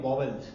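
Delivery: "वॉवेल्स" in two syllables, vaw-vuls. Shown as a demonstration. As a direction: say "वॉवेल्स" with a second v in the middle. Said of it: "अब तक हम इसका pronunciation".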